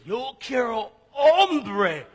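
loud, high, slow, drawn-out foreign learner; student shouting her wrong Spanish phrase; mock eager learner. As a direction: belt it out like a proud beginner of Spanish. Learner mistakes hombre for hambre.

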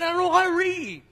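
put-on despairing young man crying out; retold desperate cry. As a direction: cry it out like a young man who can't read. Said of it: "I cried".

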